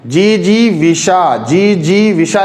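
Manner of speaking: जी जी विशा slow, every ई drawn out; written long vowels. Shown as a demonstration. Teacher reads out the spelling, long vowels. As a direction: stretch each जी in जी जी विशा. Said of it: "अब देखिए लिखा है".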